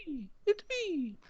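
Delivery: tiny high, soft squeak; very high, drawn-out little cartoon voice. Joking the actor is easy to replace, squeaky voice.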